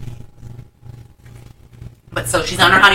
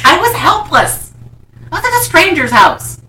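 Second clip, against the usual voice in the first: indignant protest; 12 semitones higher, 5 dB louder than usual. Real, not acted. Teased storyteller defends herself to her friend, indignant protest. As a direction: protest loudly in a raised pitch.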